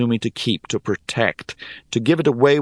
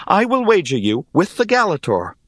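higher-pitched, earnest declaration; declaring; 9 semitones higher than usual. A narrator's reading. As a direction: announce the wager firmly, pitch raised. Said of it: "I declared".

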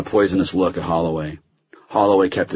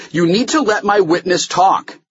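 higher-pitched, insistent demand; loud demand; 13 semitones higher, 4 dB louder than usual. A narrator's reading. Holloway demands his witness be heard, loudly. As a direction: raise your voice and insist. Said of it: "Holloway said loudly".

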